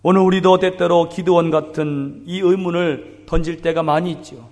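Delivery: ordinary delivery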